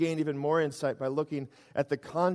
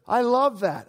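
delighted exclaim; 7 semitones higher, 7 dB louder, slower than usual. Real, not acted. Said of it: "preacher's delight".

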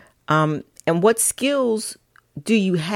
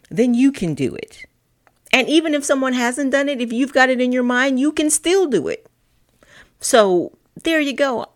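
higher, louder, rallying; rousing encouragement; 7 semitones higher, 4 dB louder than usual. Real, not acted. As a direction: lift them up, build conviction.

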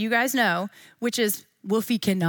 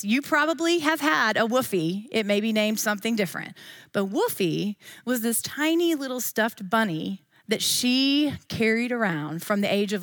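ordinary talk

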